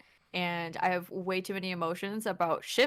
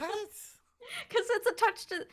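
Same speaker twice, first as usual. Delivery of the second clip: high, slow laughing words; 14 semitones higher, much slower than usual. Real, not acted. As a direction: giggle while explaining. Laughing at own blunder.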